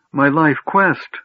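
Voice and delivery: high, slow plaintive moan. A narrator's reading. Gnome shut out from his life's work, moaning. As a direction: draw it out high in distress. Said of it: "the gnome moaned".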